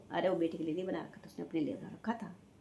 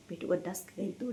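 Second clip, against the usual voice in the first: ordinary talk, nothing marked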